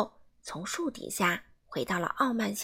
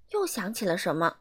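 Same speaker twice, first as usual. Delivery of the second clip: plain reading voice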